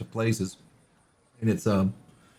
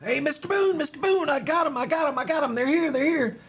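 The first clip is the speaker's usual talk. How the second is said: put-on high, eager child's voice; an excited kid who can't stop bouncing; breathless little boy's voice; excited kid Pip. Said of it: "Pip comes bounding in".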